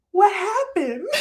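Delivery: high, startled "what happened?"; shocked disbelief. Retold or imitated. Host recalls first hearing a Baltimore accent, mock shocked.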